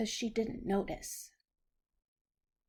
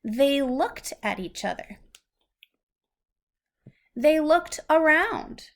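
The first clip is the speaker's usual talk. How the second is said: lively, louder storybook reading; 4 semitones higher, 7 dB louder than usual. Acted. Parent reads the happy turn of the story to a child, lively storybook reading. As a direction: build the moment for the child.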